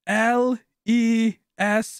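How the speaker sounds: mock spelling letter by letter, very slow; slow dim spelling. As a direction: drag out each letter like a confused guy. Imitating Lester spelling red.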